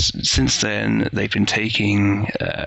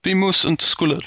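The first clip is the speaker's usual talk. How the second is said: put-on Moose and Squirrel accent; 8 semitones higher than usual. Urging a cartoon-accent impression.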